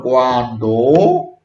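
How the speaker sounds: "cuando" drawn out low, rising at the end; questioning. Shown as a demonstration. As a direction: stretch "cuando" into a slow question. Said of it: "Por ejemplo, cuando".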